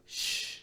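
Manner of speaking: unvoiced hush; whispered shh. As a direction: breathe a soft shh. Imitating the ending sound.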